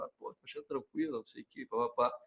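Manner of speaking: quiet mock-casual mumble among classmates; hushed aside. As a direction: keep it under your breath, barely voiced. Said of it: "quieto na minha".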